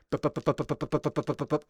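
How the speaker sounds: fast mouth-made gunfire; rapid shotgun bups. Shown as a demonstration. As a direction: mimic rapid shotgun fire with your mouth. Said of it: "were like this".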